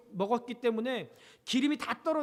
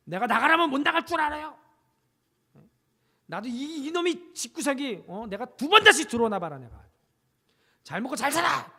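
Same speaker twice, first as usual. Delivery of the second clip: lightly voiced rebellious son, louder; defiant son; 2 semitones higher, 5 dB louder than usual. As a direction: talk back like a sulky teenager. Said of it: "이 아들이".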